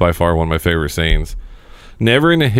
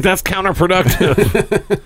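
higher, slow, laughing through it; high amused quote; 13 semitones higher, much slower than usual. Retold or imitated. Host retelling chat with friend, slow laughing quote. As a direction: drawl it out while laughing. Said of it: "I was like".

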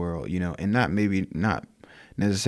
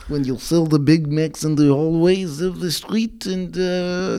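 hammed-up Russian accent, higher; 7 semitones higher than usual. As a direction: spiel einen übertriebenen russischen Akzent. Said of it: "I'm trying to do the Russian accent".

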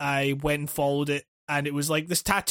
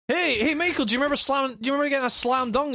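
mock fan questions; 7 semitones higher, faster than usual. Fan pestering Michael Jordan.

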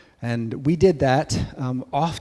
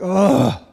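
short loud higher groan; fed-up groan; groan of disgust; 6 semitones higher, 6 dB louder than usual. Retold or imitated. Boy groaning at keeping the rock, fed-up. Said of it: "He's like".

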